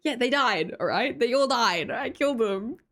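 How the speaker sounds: ordinary talk, nothing marked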